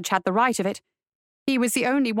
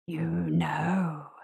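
low, soft, drawn-out spirit; eerie spirit voice; 6 semitones lower, 6 dB quieter than usual. A narrator's reading. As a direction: breathe it low like a haunting voice. Spirit voices answer her.

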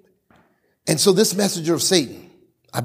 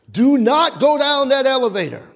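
higher, louder plea; urgent warning; 8 semitones higher, 4 dB louder than usual. Retold or imitated. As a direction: grab him and warn him off. Coworker's urgent warning retold.

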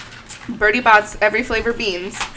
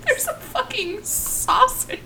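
surprised; slower than usual. Real, not acted. Spotting a gross flavor.

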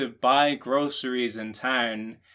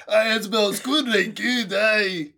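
higher, louder over-the-top Scottish lumberjack; exaggerated Scottish accent; 10 semitones higher, 7 dB louder than usual. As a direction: ham up a Scottish woodcutter, grinning. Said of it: "other accents than the one you're doing".

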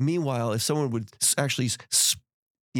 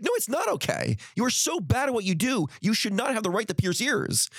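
higher, raised-pitch scolding, reliving the shop; retold angry scolding; 8 semitones higher than usual. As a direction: tell her off as if back at the counter.

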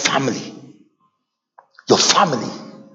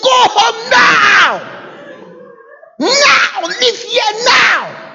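angry order; much slower than usual. Retold or imitated. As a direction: order them out furiously. Retold order to leave.

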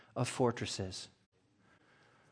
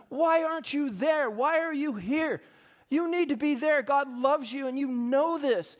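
earnest plea; 9 semitones higher than usual. Retold or imitated. Sisters urging the man to go home, earnest high plea. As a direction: plead gently but firmly with him. Sisters read his life back.

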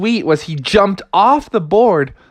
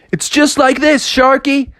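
high, chirpy surfer frog; eager showing off; 11 semitones higher than usual. Acted. Frog teaching shark to surf, eager high call.

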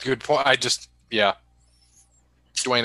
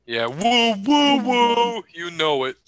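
catchphrase woo; 8 semitones higher, slower than usual. Retold or imitated. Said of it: "You can see why it's annoying".

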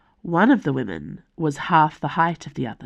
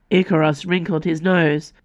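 plain reading voice

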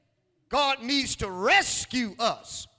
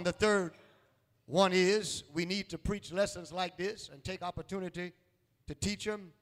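ordinary delivery

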